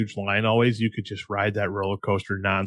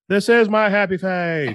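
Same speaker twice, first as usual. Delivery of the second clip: grumpy Tommy Lee Jones; 9 semitones higher, 3 dB louder, slower than usual. Retold or imitated. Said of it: "he looks at him all pissed off and he goes".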